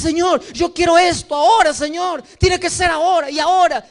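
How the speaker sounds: high, loud impatient begging; childish demand. Retold or imitated. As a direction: demand it now like a spoiled child. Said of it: "Y dices". (exigencia caprichosa, aguda y fuerte)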